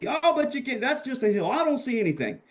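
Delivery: high, whiny mocking imitation; mock naysayers. Imitating people who don't understand the image, mocking. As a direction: make the doubters sound silly. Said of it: "most people that don't understand this, they're gonna go".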